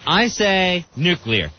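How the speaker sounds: nuclear said as new-clear, two syllables, slow. Shown as a demonstration. Host asks him how he says it, careful two-syllable nuclear. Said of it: "How do you say this?".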